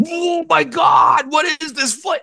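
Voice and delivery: high, loud, drawn-out shriek of shock; freak-out shriek. Retold or imitated. Retelling friend's shriek at her first Fernet shot. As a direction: squeal like a cartoon freaking out. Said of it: "Roger Rabbit freak out reaction".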